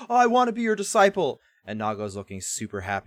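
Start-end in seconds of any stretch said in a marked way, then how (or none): 0.1-1.4 fast, much higher, loud eager shout; eager yell. Retold or imitated. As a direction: shout it out, begging to be taken on. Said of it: "stands up and yells out".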